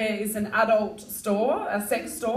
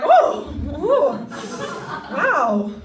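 louder, higher breathy gasp; mock arousal; 6 semitones higher, 7 dB louder, much slower than usual. Turned on by coffee froth, hammed-up gasp. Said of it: "I was like".

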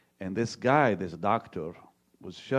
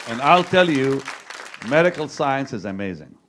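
loud proclaiming; 5 semitones higher, 10 dB louder than usual. Real, not acted. Preacher proclaiming to the congregation.

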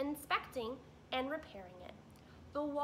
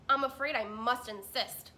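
much louder, firm insisting; gruff beaver, much louder; stubborn beaver insisting; 10 dB louder than usual. Acted. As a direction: plant your feet as the gruff beaver.

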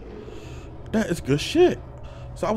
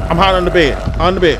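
ordinary delivery

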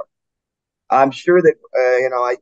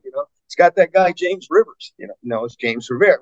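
normal talking voice

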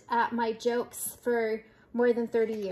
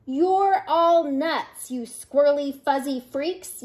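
Big Bark yelling; 3 semitones higher, 4 dB louder than usual. Acted. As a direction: holler at the whole crowd in exasperation. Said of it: "yelled Big Bark".

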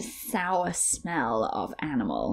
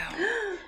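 gasp; 13 semitones higher, 4 dB quieter than usual. Acted. Police see a face.

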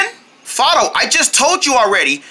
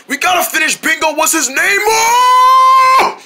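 urgent shout; 7 semitones higher than usual. Acted. Urgent plea to finish song.

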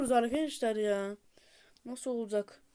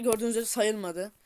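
normal talking voice

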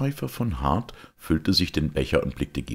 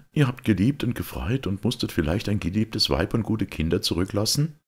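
lightly voiced youth, higher pitched; sympathetic young knight; 6 semitones higher than usual. Georg asks about the lost family, gently pitying. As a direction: ask with mild pity, raised in pitch.

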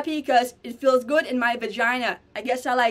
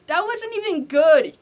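high, louder sneering critic voice; mocking critic; 7 semitones higher, 5 dB louder than usual. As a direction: mimic a scornful viewer putting her down. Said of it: "you come at me and you're like".

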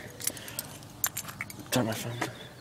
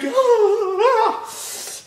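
high squealing laugh; 5 dB louder than usual. Real, not acted.

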